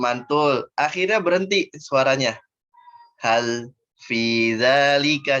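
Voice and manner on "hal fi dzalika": hal fi dzalika with a break after the lam; wrong: voice stops on the lam; stopped lam. Shown as a demonstration. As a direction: cut the sound off at the l of hal, then go on. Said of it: "jadi saktah".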